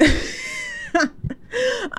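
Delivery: sudden shrill burst of laughter, very high; mock laugh at the awkward moment; high squealing laugh. Narrator laughing at the stranger's fuss, squealing.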